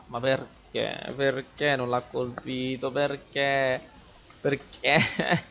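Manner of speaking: slow, drawn-out whining disbelief; lamento esasperato. Real, not acted. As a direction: drag out each perché in despair. Whining after a missed hit. (lamentela esasperata, lenta e trascinata)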